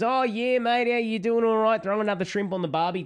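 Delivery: mock-Aussie bloke, over the top; lightly hammed Australian accent; broad Australian accent. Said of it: "When an Australian comes in and goes".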